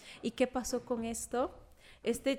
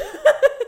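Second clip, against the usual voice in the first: shrill burst of laughter, much higher; high loud laugh; 15 dB louder than usual. Real, not acted. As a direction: crack up at yourself. Laughs at disobeying dad.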